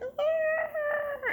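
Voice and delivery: acted high-pitched cry of fear; panicked wail. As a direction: let out a high terrified "ah". Acts out losing it.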